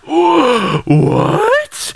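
high, gasping shocked cry; mock stunned outcry; shocked what. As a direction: react like the plot just betrayed you.

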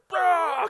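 a loud, high mock growl "Rah"; mock roar. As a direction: roar at him playfully. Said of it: "with that sort of like".

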